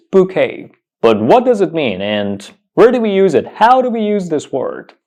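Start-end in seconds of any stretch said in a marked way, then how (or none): 0.1-0.7 bouquet as "boo-KAY", T unsounded; correct pronunciation. Shown as a demonstration. Teacher confirming it for learners, bouquet as "boo-KAY". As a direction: say "bouquet" ending in "kay", falling tone. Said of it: "The correct pronunciation of this word is".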